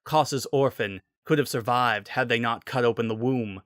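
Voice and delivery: ordinary delivery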